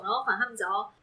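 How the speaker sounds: ordinary delivery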